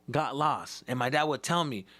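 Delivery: ordinary talk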